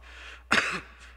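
a loud, harsh hacking cough. Acted. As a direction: cough hard from the smoke. Character coughs in the smoke.

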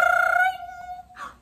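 high, loud startled gasp; a loud shocked gasp at the alarm. Acted. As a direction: jolt awake with a gasp. Alarm clock wakes him.

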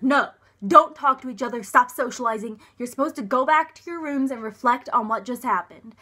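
loud, hectoring order to break it up; a staffer yelling at them to split up; angry scolding shout. Retold or imitated. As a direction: bark the rules at them like a furious chaperone. Someone runs up, scolding them.

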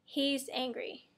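ordinary talk, nothing marked

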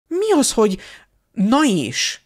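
can't believe what she just heard; indignant disbelief. Acted. Hermione to Harry, incredulous and indignant.

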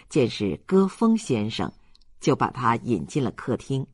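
usual speaking voice

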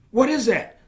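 ordinary delivery

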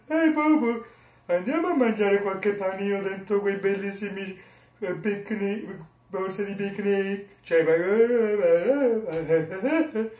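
high, slow goofy cartoon bear; Yogi Bear. Yogi inviting Bobo to picnic baskets, cartoon bear voice. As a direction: chuckle and stretch the words like a cartoon bear. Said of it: "chi è che non conosce l'orso Yogi?".

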